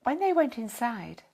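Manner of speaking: plain reading voice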